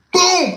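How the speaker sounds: sharp high shouted boom, like a fist on a cell door; loud bang imitation. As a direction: slam the word out as a door bang. Door-banging in cell block.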